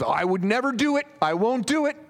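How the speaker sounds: high, loud insistence; Peter protesting. Acted. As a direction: swear you'd never. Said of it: "And Peter says".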